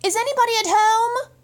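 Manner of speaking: calling up to empty windows, calling out; called out. A narrator's reading. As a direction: call up to the empty house. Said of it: "called out".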